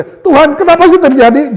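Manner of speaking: very high, loud whine; mock complaint to God. Believer blaming God, loud high mock whine. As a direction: cry out at God in hammed-up indignation.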